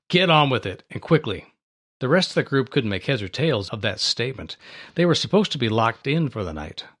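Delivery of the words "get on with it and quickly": ordinary talk, nothing marked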